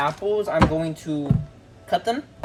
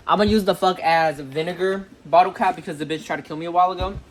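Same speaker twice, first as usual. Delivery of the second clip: usual speaking voice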